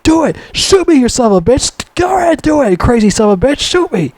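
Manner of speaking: high, strained mock yell; desperate daring. Man at gunpoint daring Rambo to shoot, frantic high yelling. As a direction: act out a wild, high-pitched dare to fire. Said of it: "Rambo was, like, pointing a gun in his face".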